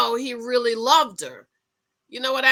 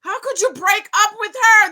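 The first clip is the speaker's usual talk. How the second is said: loud, high outraged shout; shouting at Zach; 13 semitones higher, 11 dB louder than usual. Retold or imitated. Vlogger yelling at a cast member on screen, high outraged shout. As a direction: yell at him in disbelief. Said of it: "because I'm like".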